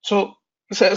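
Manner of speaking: normal talking voice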